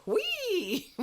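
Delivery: very high, loud mimicked whee; gleeful squeal. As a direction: squeal it in mock delight. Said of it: "and he's going".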